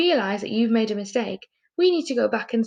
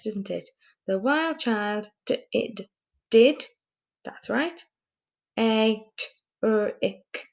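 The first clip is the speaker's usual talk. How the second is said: ordinary delivery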